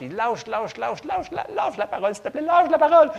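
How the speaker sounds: a light put-on voice, coaxing "lâche", rising to a loud high plea on "s'il te plaît"; coaxing devil voice building to a loud high plea; tempting enemy voice. Enemy tempting to drop the word.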